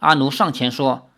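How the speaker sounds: ordinary delivery, nothing marked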